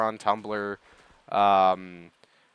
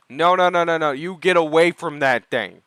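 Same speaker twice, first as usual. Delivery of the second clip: loud, higher rush of no's into a firm warning; mock outraged scolding; 4 semitones higher, 8 dB louder than usual. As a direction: scold loudly in comic outrage.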